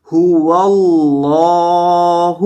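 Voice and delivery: هو اللہ with a rounded-lip ha from the throat. Shown as a demonstration. Teacher demonstrating the throat sound of ha. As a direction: round the lips on the ha of هو. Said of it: "اس کی آواز آتی ہے".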